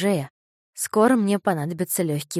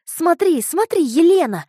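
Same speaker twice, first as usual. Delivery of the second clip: put-on high, loud eager boy; excited shout; 10 semitones higher, 9 dB louder than usual. A narrator's reading. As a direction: call out excitedly, pointing. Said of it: "закричал он".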